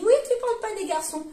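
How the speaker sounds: high, quick teasing take on her partner's complaint; mimicking her partner. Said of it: "Mon chéri me dit".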